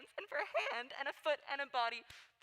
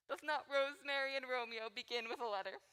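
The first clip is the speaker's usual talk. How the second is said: ordinary talk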